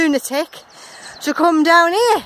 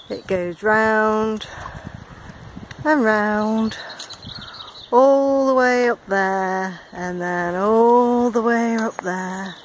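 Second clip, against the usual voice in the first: slow, lower, stretched words; drawn-out; 6 semitones lower, 3 dB quieter, slower than usual. Real, not acted. Vlogger showing viewers the long climb, drawn-out.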